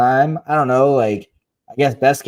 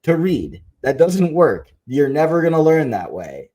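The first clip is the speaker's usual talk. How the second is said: exasperated parent, higher pitched; frustrated; 6 semitones higher than usual. Retold or imitated. Mother telling daughter to look at the book, frustrated. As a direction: re-enact losing patience with a child. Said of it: "I get frustrated and I say".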